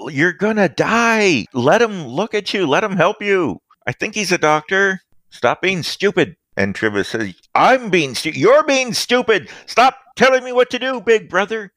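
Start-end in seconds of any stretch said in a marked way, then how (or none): 0.1-6.4 brother shouting. Acted. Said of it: "His brother shouts". 7.5-11.7 angry retort. Acted. Villain snaps back at brother.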